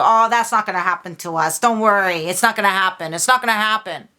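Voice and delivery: quick, dismissive imitation of deniers; mock brush-off. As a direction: mimic someone waving the worry away. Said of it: "Instead, you go".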